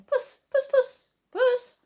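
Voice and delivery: high, loud call for a cat; an old man hunting for his cat, calling high and loud. A narrator's reading. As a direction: sing out to a lost cat, high and carrying.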